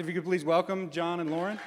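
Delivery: ordinary talk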